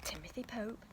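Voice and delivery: ordinary delivery, nothing marked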